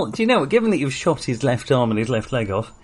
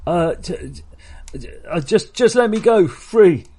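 lightly Welsh, stammering plea; a hint of a nervous Welsh raider; Welsh accent; 6 semitones higher than usual. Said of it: "your disturbing voice a sort of a, a Welsh accent".

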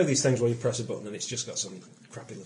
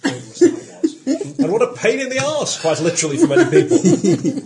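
laughing; 11 semitones higher, 9 dB louder than usual. Real, not acted. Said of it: "can you imagine how pathetic".

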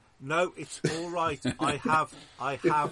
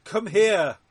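coaxing call; 9 semitones higher, 9 dB louder than usual. Pretend. Coaxing Mortimer.

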